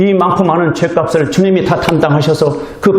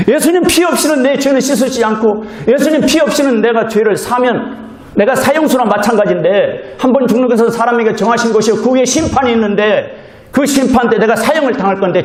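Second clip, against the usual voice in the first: higher, earnest pleading confession; fervent inner confession; 7 semitones higher than usual. Acted. Preacher voicing a believer's inner realization, raised and fervent. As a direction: pour out the realization, rising in pitch.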